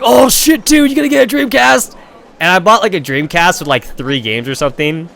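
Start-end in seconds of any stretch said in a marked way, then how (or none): 0.0-1.9 much higher, excited disbelief; amazed friends. Retold or imitated. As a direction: gasp it out like the best news ever. Friends amazed at Dreamcast purchase.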